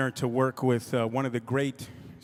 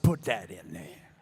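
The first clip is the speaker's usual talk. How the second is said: low curt command; 8 semitones lower, 3 dB quieter than usual. Retold or imitated. Bill's low curt instruction.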